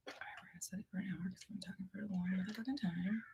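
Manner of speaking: fast breathy whisper while setting a timer; whispered aside while fiddling with the timer. Real, not acted. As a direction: mutter it under your breath, quick.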